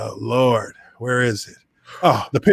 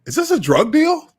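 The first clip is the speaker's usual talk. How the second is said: much higher, mock-baffled squeak; incredulous disbelief; 12 semitones higher than usual. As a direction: play it like you can't believe what's happening. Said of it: "And I'm looking at him like".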